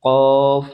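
emphatic Arabic letter demo; forceful qaf. Shown as a demonstration. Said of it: "দুই নক্তাওয়ালা".

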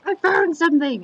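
excited discovery. Retold or imitated. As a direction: shout it like a kid who struck gold. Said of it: "he's like".

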